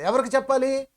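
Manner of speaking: ordinary delivery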